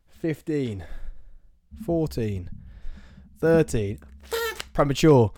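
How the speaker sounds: shouted countdown, building to cheers; new year countdown crowd. Real, not acted. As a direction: count down loud and excited.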